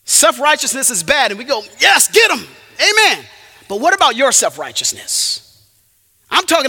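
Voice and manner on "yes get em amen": loud, high mock amen; imitating a fired-up congregation; cheering approval. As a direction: egg the preacher on from the pew.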